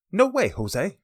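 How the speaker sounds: a breezy, cheeky brush-off; casual slangy denial. Retold or imitated. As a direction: flip it off like everyday slang. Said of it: "A more refined version of".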